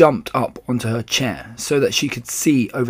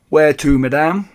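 loud, raised character voice; taxi driver; 4 semitones higher, 6 dB louder than usual. A narrator's reading. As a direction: put on a cabbie's voice. Old taxi driver's dialogue.

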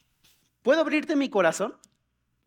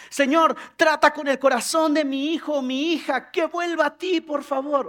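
higher, louder parental plea to God; acted fervent petition; pleading prayer; 5 semitones higher, 4 dB louder than usual. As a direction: beg earnestly for your child.

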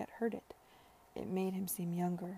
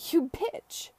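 high, loud venomous outburst; spat in anger; 9 semitones higher, 6 dB louder than usual. A narrator's reading. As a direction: spit it out furiously. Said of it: "he spat when he had recovered".

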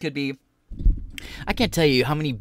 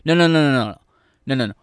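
loud repeated no; 6 dB louder, faster than usual. Real, not acted. Repeating no at clip.

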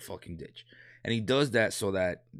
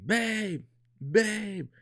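wife calling; 12 semitones higher than usual. Retold or imitated. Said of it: "my wife calls me".

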